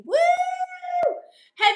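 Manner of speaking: ordinary delivery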